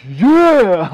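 loud high cheer of agreement; cheering shout. Retold or imitated. The straight edge dudes cheering from the background, a loud whoop.

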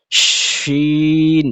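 শিন held very long, the শ hissed with a full mouth of air; drawn-out letter name. Shown as a demonstration. Quran teacher showing students the letter শিন, long and hissing. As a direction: stretch শিন slowly, letting air rush out. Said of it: "শিন উচ্চারণ করতে গেলে পুরো মুখ ভরে বাতাস বাইর হবে".

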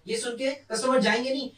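ordinary delivery

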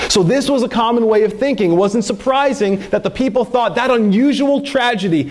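normal talking voice

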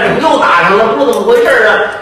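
ordinary talk, nothing marked

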